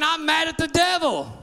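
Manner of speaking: high, shouted declaration; a hint of a churchgoer voicing anger at the devil; declaring anger. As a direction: say it like a believer finally fed up. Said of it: "I don't hear nobody saying".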